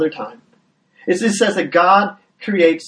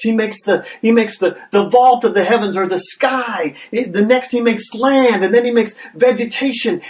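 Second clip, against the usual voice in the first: building excitement; 5 semitones higher than usual. Shown as a demonstration. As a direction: let each "what did he do next" build on the last. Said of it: "and it builds and it builds".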